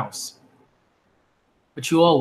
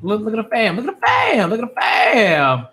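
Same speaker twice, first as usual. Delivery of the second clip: Emma voice; 5 semitones higher than usual. Retold or imitated. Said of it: "Emma's just like".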